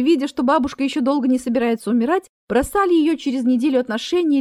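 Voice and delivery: usual speaking voice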